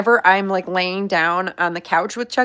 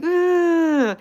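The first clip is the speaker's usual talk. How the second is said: sulky pig grunt; 9 semitones higher than usual. Retold or imitated. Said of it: "he'll be like".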